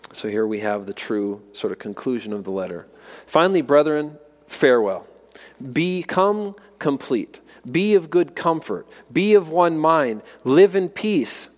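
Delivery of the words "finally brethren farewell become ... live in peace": measured solemn scripture reading. Acted. Reads the scripture blessing.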